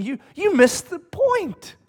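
high, slow, exasperated correction; exasperated Paul. Retold or imitated. Pastor voicing Paul correcting the Corinthians, exasperated. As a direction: set them straight, pitched high and slow. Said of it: "So Paul's going".

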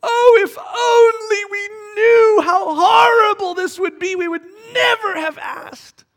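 high, loud, wailing complaint; crying-shout of people who regret it; whiny complaining crowd. Retold or imitated. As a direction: wail it like a regretful mob. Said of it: "come back later on and say".

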